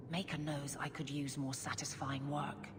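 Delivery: soft whispered mutter to herself; whispered aside. Acted. Guard captain to Hawke, a private whispered aside. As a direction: confide it under her breath.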